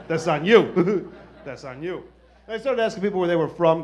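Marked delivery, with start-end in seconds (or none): 0.1-1.1 loud, drawn-out, laughing jab; emphatic blame. Acted. Comic repeat, first take louder. 1.5-2.0 soft, low repeat with a chuckle; quiet aside. Acted. Comic repeat, second take dropped.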